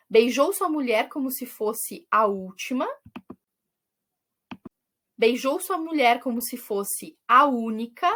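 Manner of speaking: measured reading of lyric lines, pausing between them; reciting verses. Acted. Teacher reading song verses to students, in a measured recital. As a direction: read each verse like a poem to the class. Reads the song's verses aloud.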